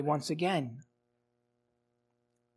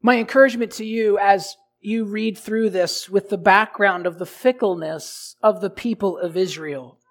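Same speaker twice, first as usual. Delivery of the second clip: ordinary talk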